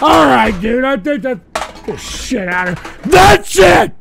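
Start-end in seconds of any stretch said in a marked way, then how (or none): 3.0-3.9 loud, angry yelling; furious shout. Real, not acted. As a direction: blow up and end the show. Shouting to end the show.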